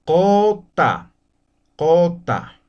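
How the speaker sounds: Cota said with an open o, the u sounding like o; pronunciation demo. Shown as a demonstration. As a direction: model Cota clearly for the class. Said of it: "se va a pronunciar como si fuera la vocal O".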